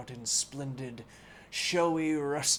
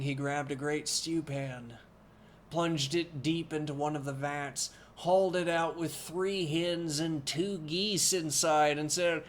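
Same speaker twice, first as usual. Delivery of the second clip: usual speaking voice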